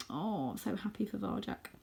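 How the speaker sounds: plain reading voice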